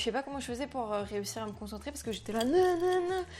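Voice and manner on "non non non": ordinary delivery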